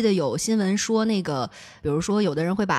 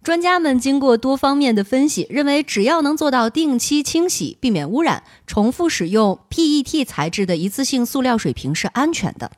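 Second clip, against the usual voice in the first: louder, formal news-reading delivery; broadcaster voice; 3 semitones higher, 3 dB louder than usual. Acted. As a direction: read it like a TV news anchor. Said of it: "播音腔马上到啊". (播音腔，正式播报新闻)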